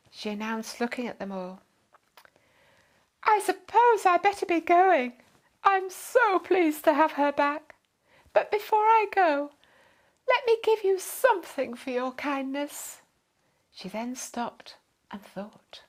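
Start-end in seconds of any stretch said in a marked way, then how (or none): 3.2-13.0 high, loud and gushing with thanks; a posh lady gushing her goodbyes; grateful fussy lady. A narrator's reading. Grateful lady takes her leave.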